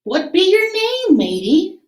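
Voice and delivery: high pirate question; pirate asking. Acted. Head pirate asks the boy his name, high hearty question. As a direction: ask the boy's name like a jolly pirate. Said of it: "the head pirate asked".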